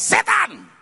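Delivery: high, loud one-word proclamation; ringing high call; emphatic shout. Acted. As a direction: cry it out to the whole congregation. Shouts the congregation's answer.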